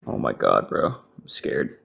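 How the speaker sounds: normal talking voice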